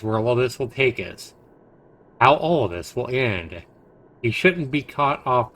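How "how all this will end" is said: usual speaking voice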